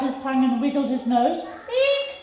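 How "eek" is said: a very high, startled eek; a frightened elephant's squeal; shouted eek. Acted. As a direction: shriek in sudden fright. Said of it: "shouted the elephant".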